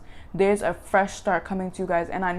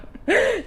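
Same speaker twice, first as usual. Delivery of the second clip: short, much higher laugh. Real, not acted. Reader laughs after nearly crying over the cards. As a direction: laugh off the tears.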